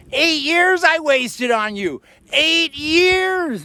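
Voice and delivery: high mock wail, slowed; imitating a yelling woman. Woman yelling at her ex in the car, high mock wail. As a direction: pitch way up and drag it out bitterly. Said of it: "girl was just yelling and yelling".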